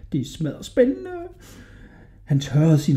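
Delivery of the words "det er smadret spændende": high, soft thrilled murmur; boy's private excitement. A narrator's reading. Hodja alone with the carpet, softly thrilled. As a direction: marvel to yourself in a boyish voice. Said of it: "sagde Hodja til sig selv".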